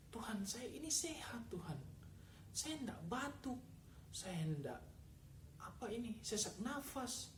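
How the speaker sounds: hushed, pleading plea to God; whispered prayer. Retold or imitated. Man praying alone in his room, whispering. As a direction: breathe the words as a desperate private prayer.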